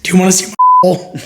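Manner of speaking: mock shout. Crude joke shouted at cohost.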